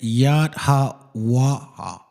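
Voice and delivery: "Yat Ha Waha" sounded out slowly, part by part; slow teaching demo of "Yat Ha Waha"; careful pronunciation. Shown as a demonstration. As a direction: stretch "Yat Ha Waha" into separate syllables. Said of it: "it's saying it".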